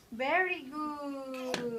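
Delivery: ordinary delivery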